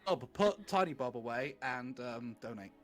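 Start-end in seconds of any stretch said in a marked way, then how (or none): none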